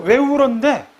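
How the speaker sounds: showing how nuance changes the question; nuanced question. Shown as a demonstration. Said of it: "뉘앙스에 따라서".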